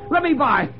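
very high, frantic cries; panicked, strained screaming confession; hysterical shouting. A narrator's reading. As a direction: rave in desperate terror, pitch at the top. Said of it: "he shouted".

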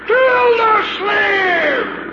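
long drawn-out furious yell; angry shout. Acted. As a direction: bellow his name across water. Mayor yells from shore.

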